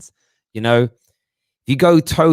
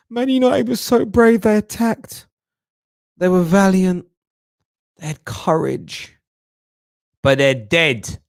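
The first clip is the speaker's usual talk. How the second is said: lightly voiced higher mock-admiring pundit; mock pundit praise; 8 semitones higher than usual. Fans imagining how pundits praise United, higher mock tribute. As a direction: sing the praise with a hint of mockery. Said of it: "I don't wanna be remembered".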